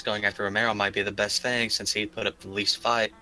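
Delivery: ordinary talk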